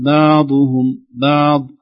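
بعضهم بعض slow and loud, ع pressed hard; wrong ain. Shown as a demonstration. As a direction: clamp the ع in بعضهم bad example. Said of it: "لا نقول". (بعضهم بعين شديدة مبالغ فيها)

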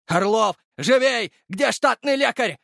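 high, urgent shouting; frantic hurrying. A narrator's reading. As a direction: hurry a subordinate along. Guard shouts for the medic. (торопящий крик высоким голосом)

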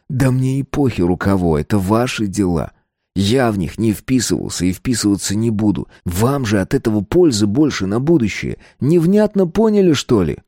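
ordinary delivery

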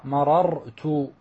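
مررت as ma-rar-tu, each r separate; unmerged double r. Shown as a demonstration. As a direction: break مررت slowly into ma-RAR-tu. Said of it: "وجب فك الإدغام". (مررت بفك الإدغام، كل راء وحدها)

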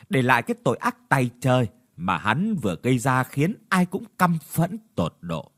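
usual speaking voice

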